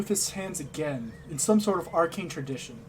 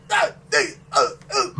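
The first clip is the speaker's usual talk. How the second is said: pained grunts; 4 semitones lower, 6 dB louder than usual. Acted. Old man falls down steps.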